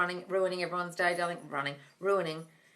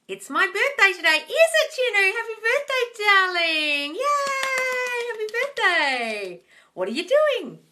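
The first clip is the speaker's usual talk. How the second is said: high, loud squeal of delight; thrilled high birthday gushing; excited birthday cheer; 13 semitones higher, 7 dB louder, slower than usual. Real, not acted. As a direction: squeal happy birthday at them. Celebrating viewer's birthday.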